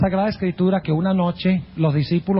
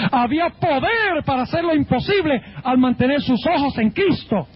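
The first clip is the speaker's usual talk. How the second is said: raised-pitch, emphatic sermon delivery; fervent preaching; 8 semitones higher than usual. Real, not acted. Preacher urging congregation to look at the cross, fervent preaching. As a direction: punch key words like a preacher.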